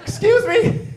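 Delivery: high, eager street canvasser pitch; chirpy fundraiser stopping passersby; mock canvasser. Street canvasser to busy passersby, hammed-up high pitch.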